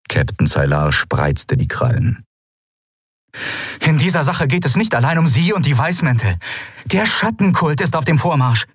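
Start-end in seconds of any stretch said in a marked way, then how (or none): 3.8-8.7 high, fast urgent plea; urgent warning. A narrator's reading. Endriel warns the captain of the shadow cult, high and urgent. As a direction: push the warning before you're cut off.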